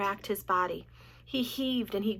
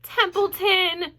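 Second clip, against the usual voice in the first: high, slow, drawn-out plea; desperate; 9 semitones higher, 7 dB louder, much slower than usual. A narrator's reading. Wilbur begging the rat in desperation, high. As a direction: plead his name in despair. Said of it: "said Wilbur in desperation".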